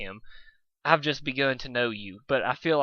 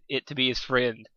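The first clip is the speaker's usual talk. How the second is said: ordinary delivery, nothing marked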